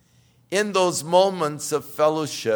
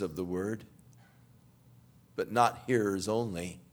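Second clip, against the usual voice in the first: soft, low prayer voice; 4 semitones lower, 6 dB quieter than usual. Acted. Pastor praying over the congregation, soft and low. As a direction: pray humbly and quietly to the Father.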